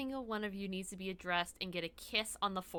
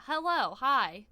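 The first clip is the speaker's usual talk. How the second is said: ordinary talk, nothing marked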